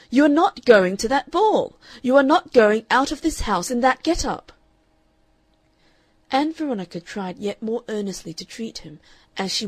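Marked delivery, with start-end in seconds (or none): 0.1-4.5 loud, higher, furious order; angry father. A narrator's reading. Father forbidding daughter to leave, loud and furious. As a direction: slam the order down on her.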